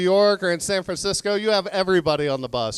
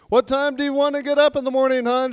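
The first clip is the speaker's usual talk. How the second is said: higher, fast, chirpy questioning; husband setting the alarm, overly cheerful; sweet husband at bedtime; 8 semitones higher, 4 dB louder, faster than usual. Acted. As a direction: ask brightly and quickly, pitched up. Husband asks wife sweetly at bedtime.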